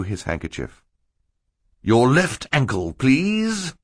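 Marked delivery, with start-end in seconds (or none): 1.8-3.7 firm, higher-pitched order; stern request with a high final please. A narrator's reading. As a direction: insist politely but sternly, lifting hard on the last word. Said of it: "he said sternly to Stefano".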